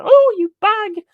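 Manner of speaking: very high, slow camp squeal; mock catty shriek. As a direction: squeal it like a hair-pulling rocker. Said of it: "pulling each other's hair and stuff like".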